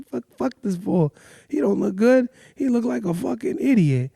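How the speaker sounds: high-pitched grumble under the breath; scornful aside. Retold or imitated. Muttered aside about the stranger.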